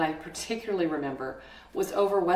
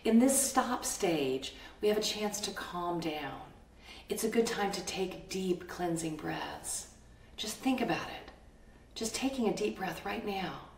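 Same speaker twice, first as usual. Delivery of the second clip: calm guiding voice; 6 dB quieter than usual. Acted. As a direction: lead them gently into calm. Guides listeners to calm breathing.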